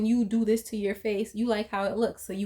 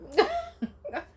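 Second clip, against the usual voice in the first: burst of laughter. Real, not acted. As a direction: crack up at the joke. Laughing at her own joke.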